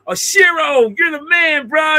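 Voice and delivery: high cheering shout; mock cheering kids. Kids cheering Shiro after the prank, high mock cheer. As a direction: hype him up, pitched high. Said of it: "it's like".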